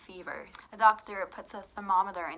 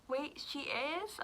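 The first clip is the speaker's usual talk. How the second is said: higher, slow, rising disbelief; taken aback; 7 semitones higher, 5 dB quieter, slower than usual. Retold or imitated. Doctor learning the patient really is blind, imitated surprise. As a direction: imitate a stunned double take.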